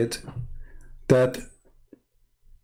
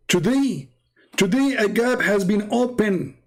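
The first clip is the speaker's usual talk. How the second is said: mock panic; 8 semitones higher than usual. Re-acting the scared quote.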